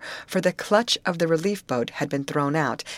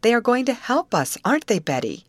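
high, louder, emphatic assurance; quick exclamation; 8 semitones higher, 4 dB louder than usual. A narrator's reading. Molly seeking Betty's backing, quick exclamation. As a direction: exclaim it, pitch dropping on the closing question. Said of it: "exclaimed Molly quickly".

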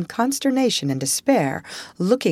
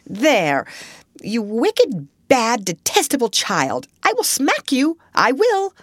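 helpless scolding; 5 semitones higher than usual. A narrator's reading. Flustered teacher scolding a kicking child. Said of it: "then in quite another tone".

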